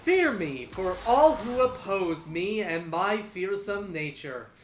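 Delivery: slow, grandiose barbarian boast; yelling barbarian. Acted. Barbarian warning all who oppose him, hammed-up slow yell. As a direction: proclaim it slowly across the room. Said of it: "yells a-, across the room".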